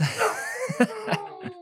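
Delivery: high hammed-up sob; mock sobbing. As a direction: sob like a ruined fan. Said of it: "this sobbing mess over here".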